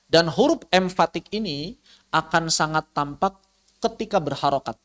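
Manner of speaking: ordinary talk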